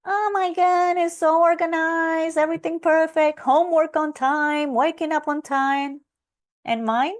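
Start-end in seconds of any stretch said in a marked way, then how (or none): 0.0-6.0 gushing over sister's room. Retold or imitated. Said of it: "her room used to look like this".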